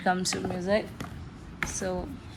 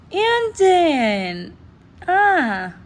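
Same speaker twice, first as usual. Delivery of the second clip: higher, louder delighted cry of the name; excited squeal; 9 semitones higher, 7 dB louder, much slower than usual. Real, not acted. Fan crying out with joy at a photo card. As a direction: gush over the card in a happy squeal. Pulls another member's card.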